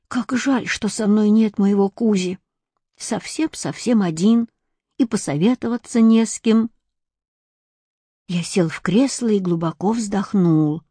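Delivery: ordinary delivery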